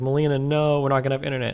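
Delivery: loud, high mock protest; retold yelled plea. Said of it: "I was like".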